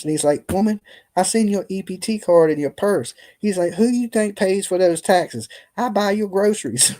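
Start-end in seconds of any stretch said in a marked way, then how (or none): 0.5-3.1 higher-pitched mock indignation; retold telling-off. 3.7-6.9 mock-indignant, pitched well up; high, amused imitation of a rant; retold telling-off.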